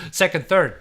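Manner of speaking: laughing through the words. Real, not acted. Host laughing at his own iPad generation mix-up, laughing. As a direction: giggle out the count, amused at himself.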